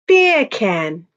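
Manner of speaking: playful British "Beer can", R dropped; British "Beer can" that passes for Jamaican "bacon"; British accent. Shown as a demonstration. Streamer repeats the joke to chat, British accent. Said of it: "with a British accent".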